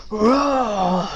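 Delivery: long drawn-out imitated roar; rocket roar. A narrator's reading. Rocket firing after Duck presses go, roaring.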